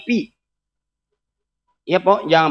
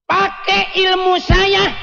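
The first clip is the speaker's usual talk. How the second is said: much higher, cocky show-off voice; mock boast; 11 semitones higher, slower than usual. Shrill mock boast in high voice.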